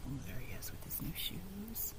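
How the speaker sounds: whisper. A narrator's reading. Narrator's whispered aside.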